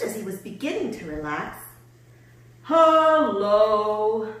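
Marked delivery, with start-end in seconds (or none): 2.6-4.3 big, drawn-out loud greeting; shouting. A narrator's reading. Tortoise arrives to visit Hank, shouting. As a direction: holler hello from afar. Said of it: "shouted Tortoise".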